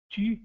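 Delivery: "Tu" com lábios arredondados, quase um i; tu francês. Shown as a demonstration. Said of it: "fazendo o arredondamento do, dos lábios". ("Tu" com os lábios arredondados, som quase de i)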